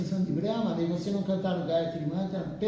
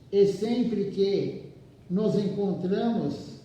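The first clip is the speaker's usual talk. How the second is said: slow, measured reading with pauses between phrases; leitura de livro em voz alta; slower than usual. Acted. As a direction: read the text out deliberately, phrase by phrase. Reads the book aloud to listeners. (leitura pausada, frase por frase)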